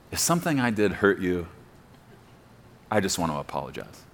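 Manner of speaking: soft, low, condescending apology. Retold or imitated. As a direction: talk down to her while apologizing softly. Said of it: "I vaguely say to you in a condescending way".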